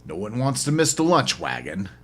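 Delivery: ordinary delivery, nothing marked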